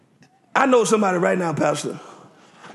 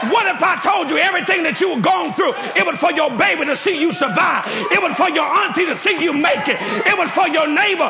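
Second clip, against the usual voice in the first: preaching climax; 5 semitones higher than usual. Acted. Pastor urging the church to praise, preaching at full force with a sung edge. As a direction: lift it into a singing whoop.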